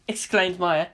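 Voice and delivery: plain reading voice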